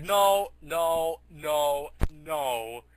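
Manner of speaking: loud, separate cries stepping down in pitch; mock horrified refusal. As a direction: belt out each no in dismay. Character refuses the singer's arrival.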